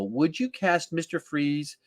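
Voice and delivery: usual speaking voice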